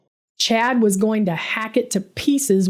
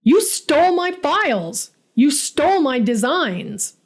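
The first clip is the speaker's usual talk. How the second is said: angry yell; 5 semitones higher than usual. Acted. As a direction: throw the accusation at him. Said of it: "I yelled, my voice cracking".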